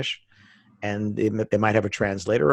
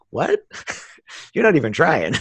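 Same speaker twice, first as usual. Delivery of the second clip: mock disbelief; 6 semitones higher than usual. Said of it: "it comes across like".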